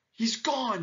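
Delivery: high-pitched excited announcement; shouted excitement. A narrator's reading. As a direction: cry out the happy news. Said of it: "shouted the peccaries".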